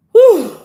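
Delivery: a high, loud exhale of breath; breathless whew. Real, not acted. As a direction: blow out a winded whew. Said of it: "out of breath from talking so much".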